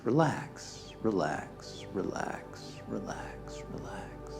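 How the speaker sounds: soothing repetition that fades to a whisper; calm mantra dying away; fading relaxation cue. Real, not acted. Sends relax message up the body.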